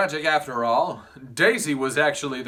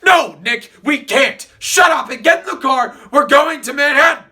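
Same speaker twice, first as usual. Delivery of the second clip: loud bossy shout; 10 semitones higher, 10 dB louder than usual. Acted. Tom shouts at Nick.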